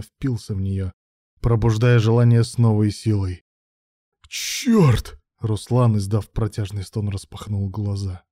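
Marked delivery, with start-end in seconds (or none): 4.3-5.2 high exclaimed curse; gasped curse. A narrator's reading. Man overwhelmed by sudden sensation, gasped curse. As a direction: blurt it out high, falling away.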